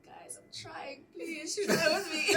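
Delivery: slow, laughing plea with a crack of tears; pleading through laughter. Retold or imitated. Teacher begging her students, laughing. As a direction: beg the class while laughing. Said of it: "I'm like".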